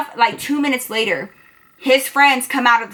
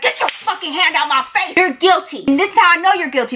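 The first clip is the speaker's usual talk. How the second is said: higher, heated retold confrontation; indignant accusation; 4 semitones higher than usual. Narrator confronts Damien over his hand in her face, indignant. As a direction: come at him pitched up and accusing.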